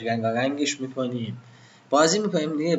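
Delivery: usual speaking voice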